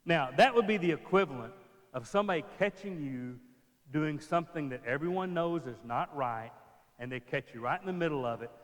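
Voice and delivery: ordinary talk, nothing marked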